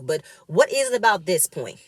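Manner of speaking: ordinary talk, nothing marked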